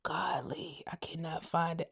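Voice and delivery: a soft whispered aside; whispered golly. Real, not acted. Searching her phone for a screenshot, whispering to herself.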